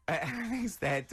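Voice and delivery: slow, high words through laughter; retelling the story while laughing; laughing while talking. Real, not acted.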